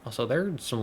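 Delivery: ordinary delivery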